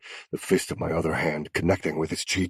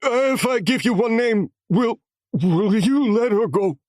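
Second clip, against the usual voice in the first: desperate bargain; 7 dB louder than usual. A narrator's reading. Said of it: "desperation as he said".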